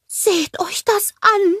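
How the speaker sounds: very high, slow snowman voice; Olaf. A narrator's reading. Snowman pointing out a find below, high drawn-out voice. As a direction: draw it out high in amazement. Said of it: "sagte er".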